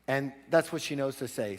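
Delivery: normal talking voice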